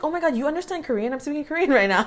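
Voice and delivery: fast, high-pitched fake astonishment; mock amazement. Suggested comeback to shocked strangers, quick mock amazement. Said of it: "you should be like".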